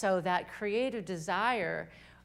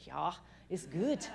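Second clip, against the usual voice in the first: slow, lightly Norwegian "Ja, it's good"; Norwegian accent; slower than usual. Retold or imitated. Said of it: "in her Norwegian accent and says".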